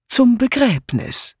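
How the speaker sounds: high start, slowly drawn-out irony; dry, teasing irony, stretched out; ironic. A narrator's reading. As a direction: say it slowly with a knowing wink. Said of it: "antwortete Miss Blacklock ironisch zwinkernd".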